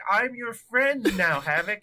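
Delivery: high, slow robot declaration. Retold or imitated. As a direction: draw it out like a sincere machine. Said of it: "And he's like".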